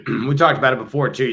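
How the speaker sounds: ordinary delivery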